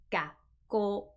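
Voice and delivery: "ga, go" with a clean silent gap between; short pause mid-word. Shown as a demonstration. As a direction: stop briefly after "ga", then say "go". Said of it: "There is a very short pause".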